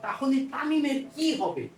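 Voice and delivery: normal talking voice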